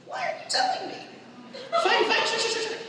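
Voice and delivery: very high squeaky disbelief; mock incredulous. Child questioning mom's secret, mock high-pitched incredulity. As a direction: pitch way up into exasperated giving-in. Acted incredulous reply to mom.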